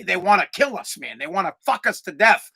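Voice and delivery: usual speaking voice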